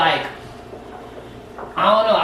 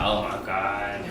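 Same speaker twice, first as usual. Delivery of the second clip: nervous dread; 9 semitones lower, 8 dB quieter, much slower than usual. Retold or imitated. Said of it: "I'm like".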